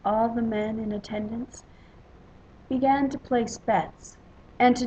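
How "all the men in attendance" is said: usual speaking voice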